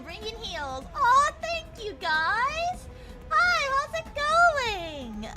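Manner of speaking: high, bubbly streamer greeting; cute anime streamer. Real, not acted. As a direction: squeal a cute hello to raiders. Greeting incoming raiders on stream.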